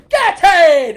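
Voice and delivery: high, loud triumphant yell with a laugh; laughing celebration yell. Pretend. Coach imagines a viewer yelling at home, laughing. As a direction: shout it out while laughing. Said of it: "if you're sitting in your house going".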